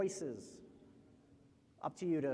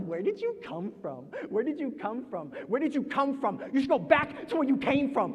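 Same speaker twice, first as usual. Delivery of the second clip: escalating hostility; 7 semitones higher, 8 dB louder than usual. Acted. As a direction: question building in pitch to a loud, angry order. Repeated question builds to hostile demand.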